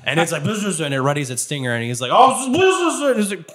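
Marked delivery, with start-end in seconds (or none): 0.4-0.8 high bee buzz. Acted. Game master as bee readying its stinger, buzzing. 2.1-3.1 high, loud buzzing; alarmed bee buzz. Acted. Game master as bee facing a stinger, loud high buzz.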